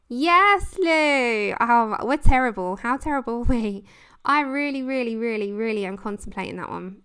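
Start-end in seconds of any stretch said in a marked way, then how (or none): none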